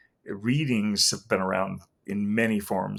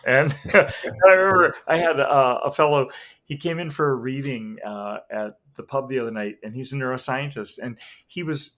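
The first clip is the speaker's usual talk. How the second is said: ordinary delivery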